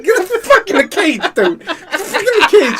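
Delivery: high-pitched frantic shout; exasperated yelling. Retold or imitated. Owner forcing the cat into its cage, frantic retold yell. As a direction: yell it like you're wrestling an animal.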